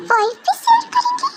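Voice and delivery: very high shocked echo; girl stunned the brothers will share a wife, shrieking; shocked squeal. Acted. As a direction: screech it in disbelief.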